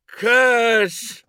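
very high, loud bellow into empty darkness; bellowed shout. A narrator's reading. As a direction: bellow the name at the shadows. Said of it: "I shouted it, bellowing at the shadows".

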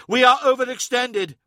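high, loud urgent shout. A narrator's reading. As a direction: yell it over gunfire. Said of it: "shouted Hathor Mart over the thunderous din".

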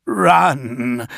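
high, desperate yell at a comrade; loud strained cry of exasperation; exasperated yell. A narrator's reading. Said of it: "yelled in exasperation".

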